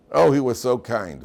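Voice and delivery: loud, emphatic mourner's praise; mock eulogy praise. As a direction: burst out with imitated funeral praise. Acting out praise at a funeral.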